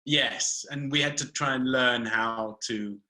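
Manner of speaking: normal talking voice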